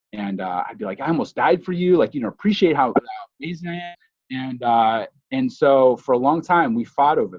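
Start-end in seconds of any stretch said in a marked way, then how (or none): 1.0-4.0 mock indignant. Arguing with girlfriend in hotel.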